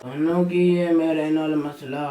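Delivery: put-on slow slurred drunken challenge; fake drunk. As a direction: slur it like a drunk picking a fight. Drunk son confronting father.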